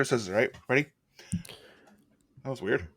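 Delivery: normal talking voice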